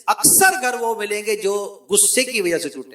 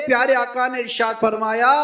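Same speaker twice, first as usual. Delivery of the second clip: ordinary talk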